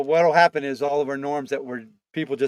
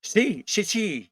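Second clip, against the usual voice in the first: mock Spanish accent; 4 semitones higher, much slower than usual. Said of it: "started speaking with a Spanish accent".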